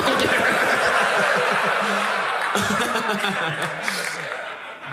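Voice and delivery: a long real laugh. Real, not acted. Comic laughing after the race joke.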